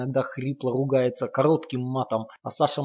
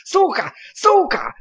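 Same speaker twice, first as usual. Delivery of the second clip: higher and louder with each curse; escalating furious roar; 8 semitones higher, 8 dB louder than usual. A narrator's reading. Lomov screaming curses at Sasha, escalating rage. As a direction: build the screamed curses to a peak. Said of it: "оглушительно орёт Ломов".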